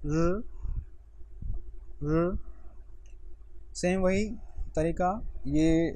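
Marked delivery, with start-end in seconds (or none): 0.0-2.4 र said with the tongue vibrating only lightly; Chinese r sound. Shown as a demonstration. Teacher showing students a sound Chinese learners find hard, lightly rolled. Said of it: "अगला sound है हमारे पास र".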